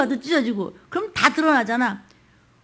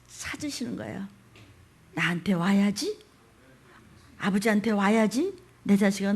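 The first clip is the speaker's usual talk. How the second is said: normal talking voice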